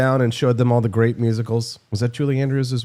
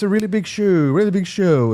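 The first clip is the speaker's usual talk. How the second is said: put-on old TV host; 5 semitones higher than usual. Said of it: "you get that reference".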